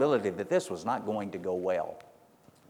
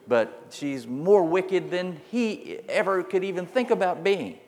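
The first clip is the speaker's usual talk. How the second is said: pitched way up in disbelief; incredulous; 7 semitones higher than usual. Real, not acted. Preacher marvels at the queen's wickedness, high and incredulous. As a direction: rise high in amazed disbelief.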